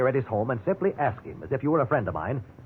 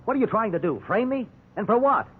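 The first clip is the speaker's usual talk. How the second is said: indignant protest; 8 semitones higher than usual. Acted. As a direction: throw the accusations back at him, incredulous. Suspect protests being framed.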